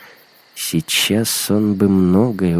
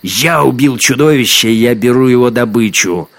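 loud, higher, forceful boast; heated, raised-voice declaration; angry shouted claim; 4 semitones higher, 6 dB louder than usual. A narrator's reading. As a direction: lay claim at full voice. Hunter shouting his claim.

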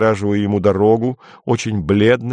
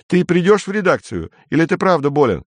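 urgent; 9 semitones higher than usual. Acted. Kensi urgent on phone.

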